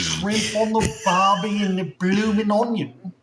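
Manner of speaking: high, hammed-up Australian twang; mock Aussie accent. Playing along with a friend's request for a silly Australian accent. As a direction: overdo a cartoon Australian accent. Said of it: "work on your Aussie accent".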